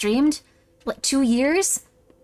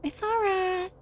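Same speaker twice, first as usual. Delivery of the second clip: high-pitched soothing mama voice; reassuring mom; 6 semitones higher than usual. Pretend. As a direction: comfort a frightened child in a high, sweet coo. Soothing child as a mom figure.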